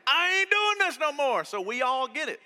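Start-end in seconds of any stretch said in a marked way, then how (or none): 0.1-1.4 high, loud hammed-up yell; dad losing it, played for laughs; high mock yell; mock daddy meltdown. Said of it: "Daddy can do the same thing. I can be like".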